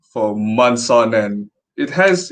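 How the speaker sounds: normal talking voice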